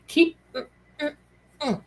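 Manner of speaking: high mm with closed lips; playful sealed-mouth hum. Shown as a demonstration. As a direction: hum as if your lips are zipped. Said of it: "lips sealed secret".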